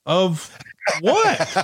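high, baffled retort; incredulous. Retold or imitated. Promoter baffled by demand for better control, incredulous. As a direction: throw it back in mock disbelief.

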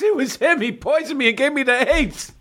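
high, panicked mock voice of Mike. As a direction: squeak it out in a hammy panic. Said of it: "Mike's like".

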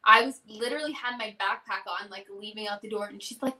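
ordinary talk

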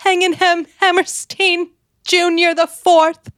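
high, slow swooning gush; swooning lady. Acted. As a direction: sigh out his name adoringly. Mock swooning reply.

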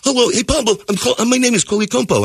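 high-pitched imitation of a foreign student; Coley Compo. Exchange student introducing himself, imitated high voice. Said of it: "he talks like this".

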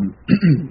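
clears a hoarse throat; quick rough throat clear. Real, not acted. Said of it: "sorry, I'm losing my voice here".

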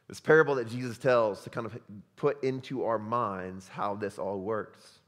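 usual speaking voice

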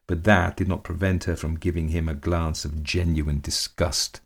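plain reading voice

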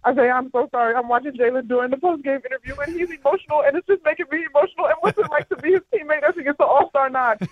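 high, choked-up, overflowing with feeling; emotional gushing. Retold or imitated. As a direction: gush at the player like you're about to cry. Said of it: "And I literally go".

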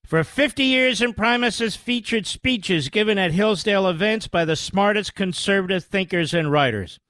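soft, polished sponsor read over music. Acted. Host reads a sponsor ad to radio listeners, softly pitched. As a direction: sell the free subscription to listeners.

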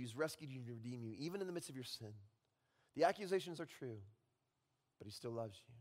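usual speaking voice

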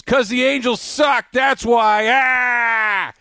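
high, drawn-out mocking shout; jeering Red Sox fan. Rival fan jeers at stranded Angels fan, mocking shout. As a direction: imitate a rowdy fan crowing in triumph. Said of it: "some Red Sox fan goes".

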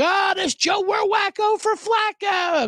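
high, slow, shouted chant; mock fan cheer. Fan cheering for Flacco, high drawn-out shout. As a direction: hype it like a giddy fan. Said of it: "I'm like".

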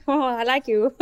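lightly goofy mock voice; goofy imitation. Co-host imitates a stunning but goofy woman, lightly goofy. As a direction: imitate someone being playfully goofy. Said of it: "she's so goofy. She's just like".

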